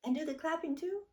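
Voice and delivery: put-on high, fast eager kid voice; excited child. A narrator's reading. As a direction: bounce with excitement, quick and high. Excited little sister asks.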